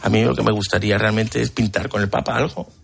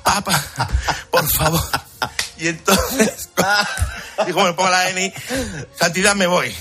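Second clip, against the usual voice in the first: higher, laughing through the apologies; laughing fluster; 9 semitones higher than usual. Retold or imitated. Painter apologizing to the Pope, laughing and flustered. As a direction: giggle out the excuses.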